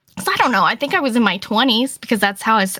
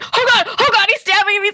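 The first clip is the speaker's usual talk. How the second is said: retold panic; 11 semitones higher than usual. Retold panic while being stabbed.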